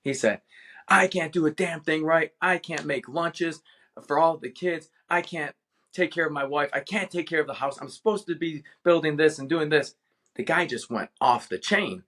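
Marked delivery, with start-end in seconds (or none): 0.9-10.0 higher, mock frustrated blow-up; retold outburst. Stressed father at the playground vents at a concerned stranger, mock outburst. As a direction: imitate an overwhelmed dad venting, pitched up. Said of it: "he blew up on me".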